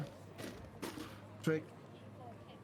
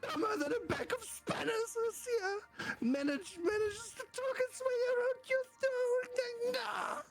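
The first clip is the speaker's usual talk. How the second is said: higher-pitched fussy neighbour complaining; grumbling neighbour. Acted. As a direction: whine about the dim assistant. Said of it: "You bring him to life".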